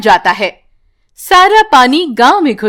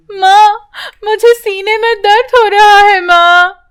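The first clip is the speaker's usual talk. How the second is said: put-on high, pained girl's voice; a child telling mother of chest pain; hurting child; 8 semitones higher, 3 dB louder than usual. Priya in pain. (ऊँची, दर्द भरी बच्ची की आवाज़)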